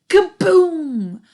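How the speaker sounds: loud high explosion shout; kaboom. A narrator's reading. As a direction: burst out with the boom. Tow truck explodes.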